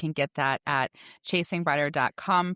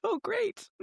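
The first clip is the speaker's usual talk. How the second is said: laughing; 5 dB quieter than usual. Real, not acted. Laughing at the story.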